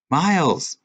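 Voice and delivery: high, loud call down the hall; shouting a name. A narrator's reading. Girl shouts after the boy through the crowded hallway. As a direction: call after him to stop. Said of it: "Alicia shouted again".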